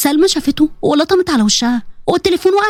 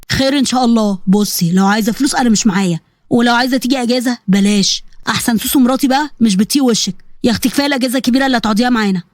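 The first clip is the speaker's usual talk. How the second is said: lower, grumbling father's voice; irritated, dismissive father; 4 semitones lower than usual. Acted. Father refusing his daughter money or visits, low and dismissive. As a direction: brush her off in a lowered voice.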